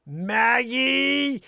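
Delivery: loud high furious call; angry yell. Retold or imitated. Wife yelling at the dog.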